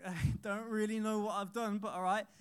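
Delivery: confused laughing reply; chuckle, unsure what you did; bemused. Retold or imitated. Boy answering the teacher with a puzzled laugh. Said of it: "I was like".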